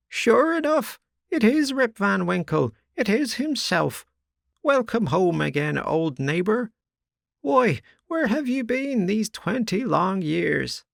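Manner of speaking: put-on very high, quavery old crone; old woman's voice. A narrator's reading. Old woman welcoming her neighbour home, high exclaim. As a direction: peer at him and exclaim.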